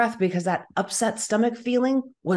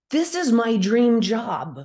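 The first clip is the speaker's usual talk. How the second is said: ordinary delivery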